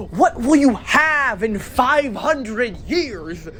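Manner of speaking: slow, high dramatic voice; dramatic quote voice. Acted. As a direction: intone it slowly like a prophet. Quoted meme line.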